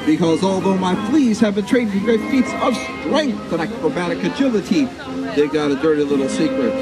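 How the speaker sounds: usual speaking voice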